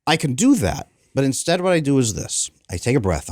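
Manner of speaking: ordinary talk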